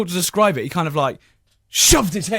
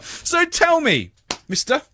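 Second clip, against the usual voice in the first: interrogating policeman; 6 semitones higher than usual. Retold or imitated. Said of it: "He was like".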